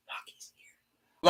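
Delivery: slow, voiceless shocked whisper. Retold or imitated. Randy spotting Punk backstage, whispered shock. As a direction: breathe it out in disbelief. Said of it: "he was like".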